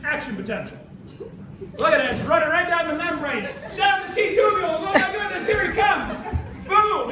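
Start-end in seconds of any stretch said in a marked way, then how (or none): 1.8-7.1 loud, high, hyped-up commentary; excited play-by-play. Pretend. Teacher cheering on the action potential for students, excited shout. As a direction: call it like a race, building to a boom.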